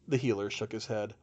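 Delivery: ordinary delivery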